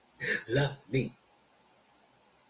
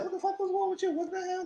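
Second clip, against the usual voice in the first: very high falsetto, softer; mock furious wife; 9 dB quieter than usual. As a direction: squeak out her outrage. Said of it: "She said".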